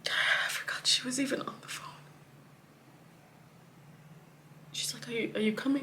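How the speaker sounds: hushed, voiceless whisper. Real, not acted. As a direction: breathe it out with no voice. Hushed on the phone.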